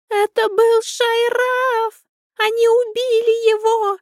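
very high, loud, slow wailing cry; high hyena wail. Acted. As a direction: shriek it like a grieving hyena. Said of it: "Высоким голосом завопила гиена". (высокий вопль гиены)

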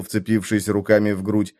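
ordinary delivery, nothing marked

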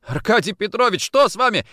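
high, startled question to a superior; alarmed plea. Acted. Orlov to his attacking boss, alarmed.

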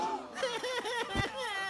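soft high-pitched chuckle; a quick giggle; high laugh. Acted. Laugh alone on a line.

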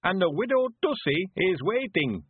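high, drawn-out jolly announcement; gleeful high rustic voice; jovial. A narrator's reading. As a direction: announce it jovially as an old countryman. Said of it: "said Mister Weevil jovially".

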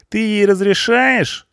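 very high, slow incredulous question; indignant punk. A narrator's reading. Punk appalled at the boss's permission, indignant. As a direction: draw the question out in high outrage. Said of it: "возмутился панк".

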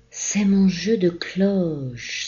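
high, slow delighted exclamation; a little girl thrilled by her bells; joyful cry. Acted. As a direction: Marie delighted, high and drawn out. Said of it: "s'écria Marie toute joyeuse".

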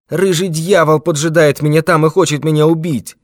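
put-on high, loud frightened old man. A narrator's reading. Old man telling the detective of his pursuer, frightened. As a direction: cry out in panic.

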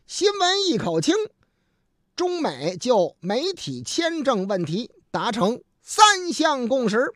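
slow, high, punchy headline delivery; news headline read. Acted. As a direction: announce each headline with drawn-out emphasis. 播报新闻提要. (抑扬顿挫地慢速播报新闻提要)

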